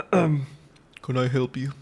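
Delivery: low menacing Can I help you; deep intimidating voice. Shown as a demonstration. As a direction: drop the voice low to scare off a stranger. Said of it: "I'm not gonna talk ... this soft voice".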